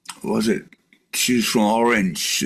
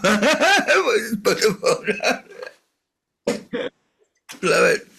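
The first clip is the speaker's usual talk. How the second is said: ordinary delivery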